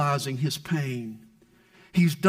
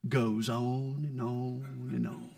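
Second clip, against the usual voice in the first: fading away; 7 semitones lower, 9 dB quieter, slower than usual. Acted. Woes trailing endlessly.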